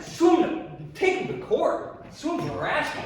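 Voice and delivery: angry outburst. Acted. Andrew furious about harassment.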